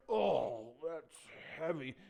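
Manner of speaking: loud, strained groan of effort; straining under weight. Acted. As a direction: groan it out as if the load is too heavy. David weighed down by armour.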